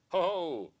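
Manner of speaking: a forced stagey chuckle that nobody means; fake laugh. Mimicking the Beatles' bored laugh, a hammed-up ho-ho. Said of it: "they laugh like this".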